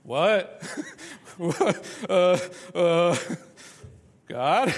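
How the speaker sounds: loud startled laughing what; stunned disbelief. Retold or imitated. Hosea stunned by God's command, laughing. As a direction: laugh in shock at the news.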